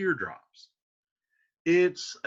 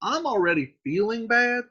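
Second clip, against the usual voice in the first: high, worked-up modern voice; exasperated paraphrase; 7 semitones higher, 3 dB louder than usual. Retold or imitated. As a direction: throw up your hands at love. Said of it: "He's like".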